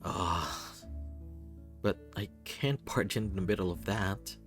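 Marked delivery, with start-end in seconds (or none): none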